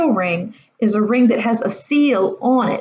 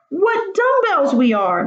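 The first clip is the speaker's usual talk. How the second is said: higher, slow exasperated groan; 10 semitones higher, slower than usual. Acted. Mucius scolding himself and friends, exasperated groan. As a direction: moan at your own stupidity. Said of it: "Mucius groaned".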